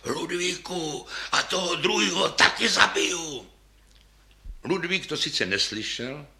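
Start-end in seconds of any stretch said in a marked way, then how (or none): 0.0-3.5 high, strained hoarse threat; hoarse wheezing. A narrator's reading. Sweating conductor threatening players mid-concert, hoarse wheezing. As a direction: force it out through a voice that has given out. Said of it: "když sýpal do pléna".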